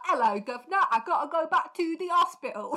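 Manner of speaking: lightly hammed London accent. Co-host voicing the doctor in cockney, light mock cockney.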